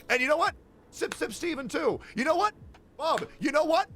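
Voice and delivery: mock them with a high, taunting voice; sarcastic mockery. Sarcastic mocking list of names.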